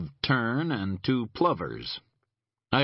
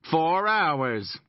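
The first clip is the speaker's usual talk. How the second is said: pointed; 8 semitones higher, 3 dB louder than usual. Acted. Boone pointedly on phone.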